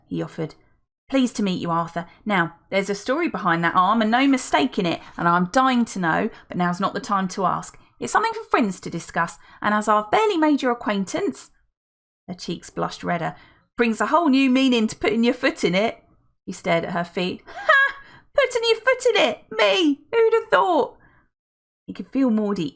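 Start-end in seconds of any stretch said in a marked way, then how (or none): 1.1-11.5 louder, gushing lady voice; chatty older woman. Acted. Felicity chatters on. 13.8-16.0 louder, gushing lady voice; chatty older woman. Acted. Felicity's joke. 17.5-21.0 high, loud, cackling delight; laughing lady. Acted. Felicity laughs at her pun.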